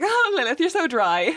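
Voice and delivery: high-pitched sitcom greeting, easing down; put-on high voice for a mocking sitcom pair; Karen and Jack. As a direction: exclaim it high, then drop a little. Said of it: "Karen and Jack, whenever they saw her, they were like".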